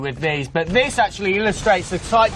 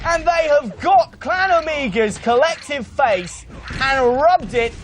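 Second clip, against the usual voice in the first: high, shouty live commentary; excited play-by-play; 7 semitones higher than usual. Acted. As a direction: call the action at full pitch.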